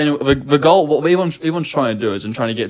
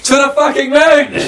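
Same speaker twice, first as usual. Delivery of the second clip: much higher, loud shouted cheer; hype cheer; 13 semitones higher, 5 dB louder than usual. Pretend. Shouting the Dogecoin slogan in hype. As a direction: cheer like a crypto fan.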